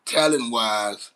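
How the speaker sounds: talent-wise drawn out slowly; slow emphasis. Acted. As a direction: lean on talent-wise, stretching it. Stressing the key comparison.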